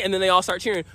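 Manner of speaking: ordinary talk, nothing marked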